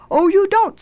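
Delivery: high, loud witch's retort; loud, high-pitched storybook threat; menacing witch. A narrator's reading. Witch turns on the girl.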